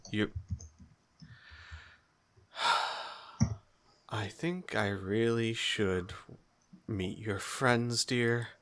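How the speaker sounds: soft, slow, sighing mother; a tired mother after long crying; weary mom. Acted. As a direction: mom drained from crying, softly asking. Worn-out mom speaks to daughter.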